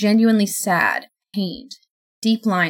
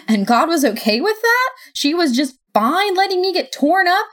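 building to a high, loud outburst; rising angry rant; 7 semitones higher, 4 dB louder than usual. Acted. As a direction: pour out the hurt, louder and higher. Sophie rants at Death.

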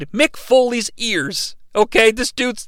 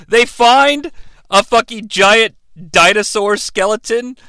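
ordinary talk

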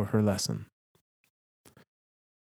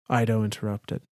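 ordinary talk

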